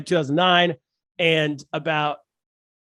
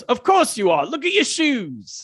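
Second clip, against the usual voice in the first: higher, slow, mocking British accent; British comic; 6 semitones higher than usual. British comedian mocking an audience member's shoes in a British accent. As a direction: sneer it like a snobby British comic. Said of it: "And he goes".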